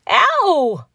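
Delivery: high, sudden yelp of pain; startled cry of pain. A narrator's reading. Donald Duck just had his foot stamped on, pained cry. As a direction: yelp as if stung. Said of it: "cried Donald Duck".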